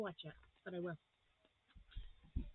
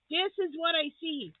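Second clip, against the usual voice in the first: excited; 6 semitones higher, 8 dB louder than usual. Real, not acted. Excited unboxing, repeated louder.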